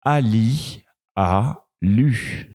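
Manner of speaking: slow, careful word by word; slow sounding out; slow reading demo. Shown as a demonstration. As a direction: read it slowly for learners. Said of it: "Je finis avec cette phrase".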